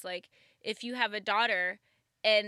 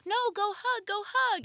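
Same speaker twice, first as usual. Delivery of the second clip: much higher, louder, coaxing parent voice; mock pushy parent; 12 semitones higher, 5 dB louder than usual. Parent pushing a little girl to hug a stranger, high and coaxing. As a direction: push the child to hug in a high voice.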